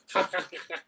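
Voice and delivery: mocking ha-ha-ha; put-on wrestler's laugh in bursts; imitated laugh. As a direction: mimic his nonstop laughing. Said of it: "But before that, it was all".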